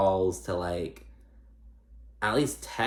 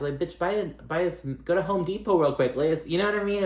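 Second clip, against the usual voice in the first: exasperated rant; 8 semitones higher than usual. Real, not acted. Ranting at cast member's choice.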